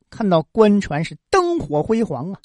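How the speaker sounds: usual speaking voice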